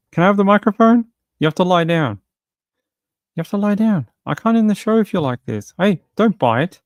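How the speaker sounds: high pet talk. Real, not acted. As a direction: plead with the pet to settle.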